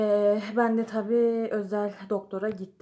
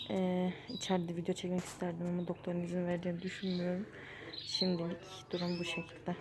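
hushed in a waiting room; speaking quietly; 7 dB quieter than usual. Real, not acted. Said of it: "Herkes bana bakıyor, konuşamıyorum o yüzden".

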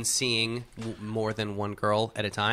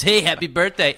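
louder, higher-pitched pickup greeting; club pickup voice; 4 semitones higher, 6 dB louder than usual. Retold or imitated. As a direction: imitate a pal hitting on girls. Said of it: "talks like this".

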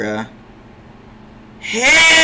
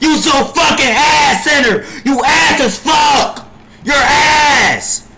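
much higher and louder, furious; angry shout; 8 semitones higher, 3 dB louder, slower than usual. Real, not acted. Streamer raging at his center, furious shout. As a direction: yell it at the useless teammate.

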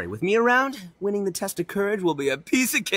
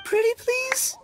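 much higher pleading whine; whiny begging; slower than usual. Acted. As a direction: beg like a big kid. Itto begs Paimon to help.

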